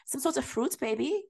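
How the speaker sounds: mock-sweet fussing over a child; softly put-on doting voice; mock doting reaction. Mimicking her cooing over the child.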